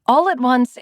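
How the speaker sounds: plain reading voice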